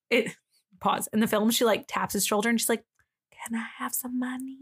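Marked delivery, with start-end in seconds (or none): 3.3-4.6 soft, unvoiced whisper. Jess tapping his shoulder for money, whispered. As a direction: whisper a timid little request. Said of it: "she, like, whispers it".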